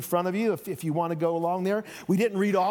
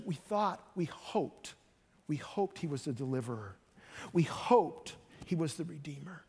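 softer, lower, deflated and sorrowful; lost hope; 3 semitones lower, 7 dB quieter than usual. Acted. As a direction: let the hope drain out of the voice. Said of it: "feeling of lost hope".

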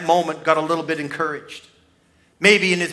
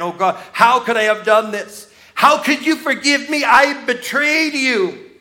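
mock crying; 7 semitones higher, 5 dB louder than usual. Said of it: "He's crying".

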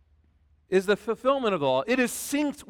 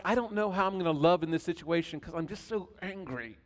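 lightly voiced angry mom, talking through her teeth; 7 dB quieter than usual. Imitating his mom when angry, through clenched teeth. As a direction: speak through clenched teeth, lips still. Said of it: "That's how my mom talked when she was angry".

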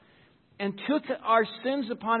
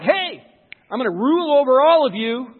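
cocky boast; 5 semitones higher, 12 dB louder than usual. Retold or imitated. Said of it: "you walk over and go like".

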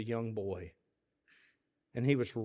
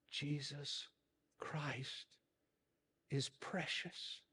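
faint, slow whisper; frail old preacher whispering; 3 semitones higher, 13 dB quieter, much slower than usual. A narrator's reading. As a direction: barely breathe the words out. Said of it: "in a whisper".

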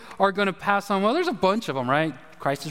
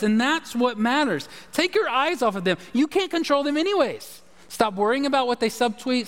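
higher, building pulpit exhortation; rising preacher fervor; 5 semitones higher than usual. Acted. Pastor urging his congregation to stop worrying, rising preaching. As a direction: drive it home to the congregation.

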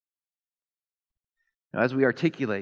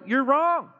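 high, louder flat contradiction; mock angry retort; 12 semitones higher, 6 dB louder than usual. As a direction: snap back in a hammed-up huff. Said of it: "they're gonna sit there and go".

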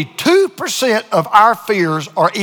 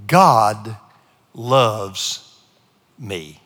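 tender; 10 semitones lower, much slower than usual. Acted. Three key words, dropped very low.

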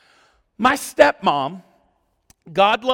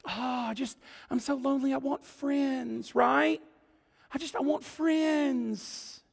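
hammed-up self-pity; mock lonely whine; 11 dB quieter than usual. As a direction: whine it like a sorry lonely soul. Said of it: "we love the idea of friendship".

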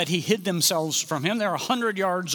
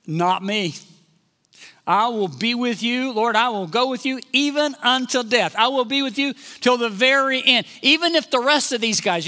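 louder, insistent pledge of loyalty; bold vow; 5 semitones higher, 4 dB louder than usual. Acted. Peter vowing loyalty to Jesus before the others, boldly insistent. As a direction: swear it emphatically, voice lifted.

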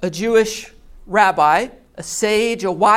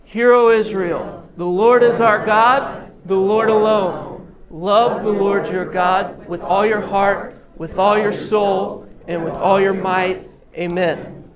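passionate prayer. Real, not acted. Pastor leads congregation in the Shema, passionate prayer. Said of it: "let's pray this with passion".

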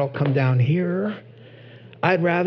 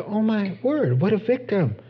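sympathetic gasp; 6 semitones higher than usual. Retold or imitated. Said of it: "you can see him like".

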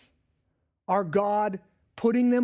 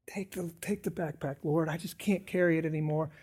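tired soft plea; 3 semitones lower, 6 dB quieter than usual. Retold or imitated. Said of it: "five minutes later".

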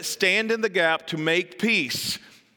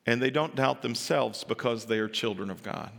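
normal talking voice

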